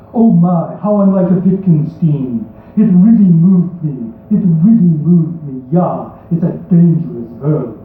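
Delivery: hammed-up, vaguely foreign Hollywood bad accent; poem read in an unplaceable fake accent. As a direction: recite it in a deliberately terrible accent. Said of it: "read it in a very bad accent".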